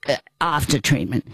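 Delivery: normal talking voice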